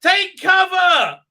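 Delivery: very high, slow, loud shriek; mock shout. Mocking the queen's warning as a high loud shriek. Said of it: "she gives the sage-like advice".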